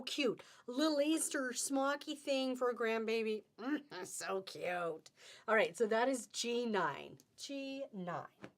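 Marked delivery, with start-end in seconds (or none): 3.6-5.1 cooing. Real, not acted. Cooing over fabric.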